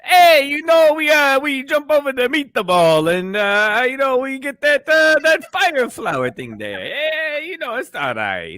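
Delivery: very high cartoon Italian accent; singsong high-pitched Italian caricature; Mario. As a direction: ham it up as a squeaky Italian plumber. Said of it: "he did the fucking Mario".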